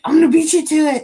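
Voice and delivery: high, loud mock one-upping; imitated eager boast. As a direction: jump in as a friend racing to be first. Acts out friends competing to send money.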